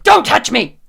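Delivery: explosive high, loud yell; angry shout. Acted. Character punching Lou away, furious shout. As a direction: shout it as a sudden lash-out. Lashes out as she punches him.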